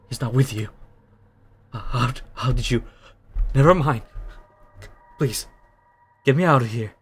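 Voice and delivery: lightly voiced pleading prisoner; desperate prisoner. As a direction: beg to be freed, rising urgency.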